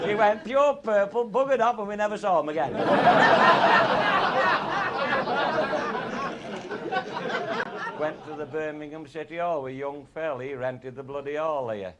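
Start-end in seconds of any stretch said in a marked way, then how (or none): 2.8-7.9 drawn-out laugh climbing higher; long rising laugh. Real, not acted. Still laughing at the engineer story, long rising laugh. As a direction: let the laugh run on and rise.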